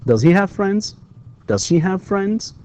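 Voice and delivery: teacher's model question, voice up on "friends"; rising intonation. Shown as a demonstration. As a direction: let "friends" lift like a yes-no question. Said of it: "The rising, uh, intonation".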